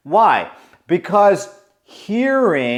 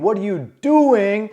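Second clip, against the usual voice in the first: mock scream; 7 semitones higher than usual. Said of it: "I thought you screamed".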